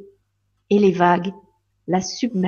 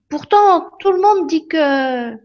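put-on high, slow child voice objecting; Lily objecting to the fairy; doubtful little girl; 7 semitones higher, slower than usual. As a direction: pitch up and drag it like a child.

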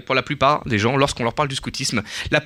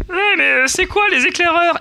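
puzzled listener voice; 5 dB louder than usual. Acted.

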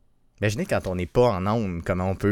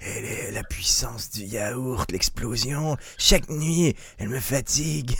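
mock whispered slogan; 6 dB quieter, slower than usual. Mock ad slogan about yogurt.

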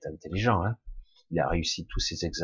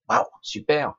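higher, louder fake wow; mock enthusiasm; 6 semitones higher, 5 dB louder than usual. Mocking the praise of a good memory, sarcastic cheer. Ironic cheer at the intelligence criteria.

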